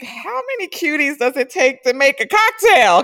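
high and loud, teasing delight; giddy quote. Retold or imitated. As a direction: marvel at all the cute guys. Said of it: "And I was like".

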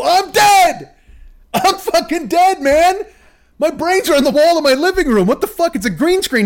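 high, loud comic exasperation; exasperated outburst. Acted. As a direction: yell it in mock despair at the fans. Exasperated comic outburst about fans.

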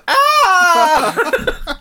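very high, loud laughing scream; laughing cry as if hit; mock pained scream. Dad shot again in sketch.

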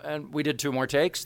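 ordinary talk

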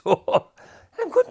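ordinary talk, nothing marked

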